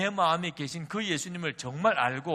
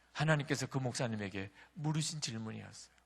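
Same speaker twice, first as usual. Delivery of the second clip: ordinary talk, nothing marked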